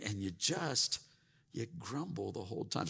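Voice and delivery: ordinary talk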